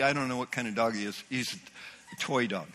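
ordinary talk

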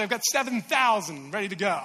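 ordinary talk

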